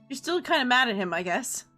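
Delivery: usual speaking voice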